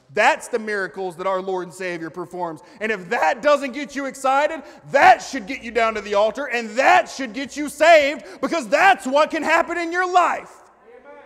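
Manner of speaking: loud, high preaching shout building to a peak; happy voice. Real, not acted. Preacher urging people down to the altar, loud fervent happy shout. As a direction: shout it with rising fervour to rouse the room. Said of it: "That was my happy voice".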